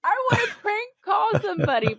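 giggling while talking; laughing. Real, not acted. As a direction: talk through laughter. Giggling about prank calls.

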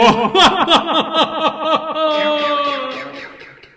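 high, loud villain laugh; evil cackle. Acted. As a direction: cackle like a vampire count. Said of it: "Count Brian Garnula".

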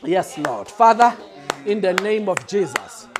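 ordinary talk

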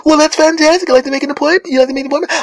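overly cheerful, parroting the caller; perky receptionist. Retold or imitated. Dental receptionist repeating the caller's request, perky and high. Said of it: "bright and sunny".

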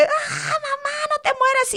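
high-pitched mock wail; tearful child's plea, very high; crying scared children. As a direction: imitate terrified kids crying for mom. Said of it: "mis hijos llorando atrás, asustadísimos".

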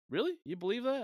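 high-pitched, loud mock disbelief; older gentleman. Older gym-goer testing a stranger, imitated in high mock disbelief. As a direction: imitate an older man, voice shooting up into the question. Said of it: "older gentleman".

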